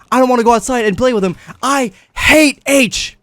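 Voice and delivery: put-on a kid yelling at the babysitter to settle it; shouting as loud as possible. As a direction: belt the line out to win the argument. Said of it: "As loud as I can".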